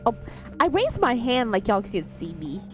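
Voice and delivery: ordinary talk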